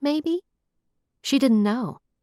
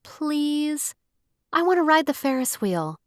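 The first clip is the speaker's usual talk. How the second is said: pouting, high and pleading; pouty plea; 8 semitones higher than usual. A narrator's reading. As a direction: whine it like a sulky child. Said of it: "Nara put on a pouty face".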